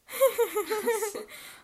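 loud, high real laugh at her own joke; high burst of laughter. Real, not acted. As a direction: crack up laughing. Laughing at own joke's end.

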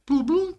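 high two-note notification sound; imitated messenger alert. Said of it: "the fucking messenger noise".

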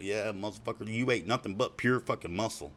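ordinary talk, nothing marked

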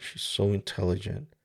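ordinary talk, nothing marked

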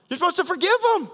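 forgive punched, high; high fast emphatic call; emphatic high preaching. Acted. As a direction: drive forgive home.

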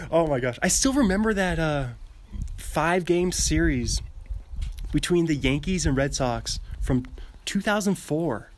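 ordinary delivery

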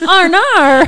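loud high words through laughter; laughing. Real, not acted. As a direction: laugh the line out. Laughing at the TikTok.

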